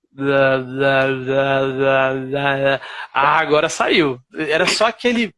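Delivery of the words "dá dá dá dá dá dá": low, monotone repeated "dá"; practice syllables. Retold or imitated. Learner rehearsing a tongue sound, low droning practice syllables. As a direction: drill the syllable "dá" evenly on one low note.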